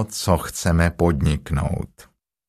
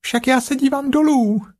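Piglet calling out. A narrator's reading. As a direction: pipe it out so the jagular hears. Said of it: "zvolalo prasátko hlasitě".

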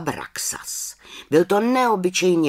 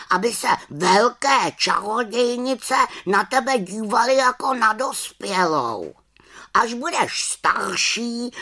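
high, emphatic raven voice; consoling raven; 10 semitones higher, 3 dB louder than usual. A narrator's reading. Raven trying to comfort the little witch, high consoling voice. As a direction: reason with her as a well-meaning bird. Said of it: "se pokoušel malou čarodějnici utěšit".

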